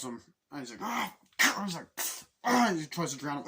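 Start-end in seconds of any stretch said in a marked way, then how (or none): 0.8-1.1 sudden high yelp of pain; pained cry. Retold or imitated. Reviewer voicing a henchman being drowned, high pained cry. 2.4-2.7 high, loud yell of pain; loud pained cry. Retold or imitated. Reviewer voicing a drowning henchman, loud high scream. Man being drowned cries out.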